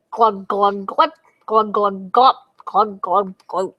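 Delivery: lightly voiced bubbling glugs; swamp glugs. A narrator's reading. Swamp bubbling before the monster comes, gurgling glugs.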